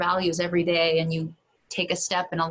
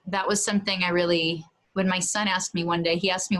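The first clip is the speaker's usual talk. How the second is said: ordinary talk, nothing marked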